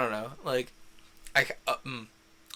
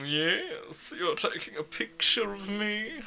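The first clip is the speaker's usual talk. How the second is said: higher, prissy put-on voice; mock haughty voice; 10 semitones higher than usual. Voicing the character's expression.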